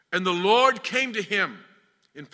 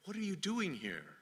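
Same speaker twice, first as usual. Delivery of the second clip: very soft, searching question; hushed voice of God; 11 dB quieter than usual. Retold or imitated. God questions someone in a spiritual valley, hushed. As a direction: drop to a near-hush to ask. God's question repeated to congregation.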